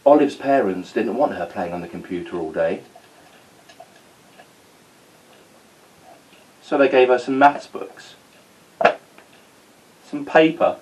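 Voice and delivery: put-on lively read-aloud storytelling for kids; story narration. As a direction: tell it like a bedtime story to children.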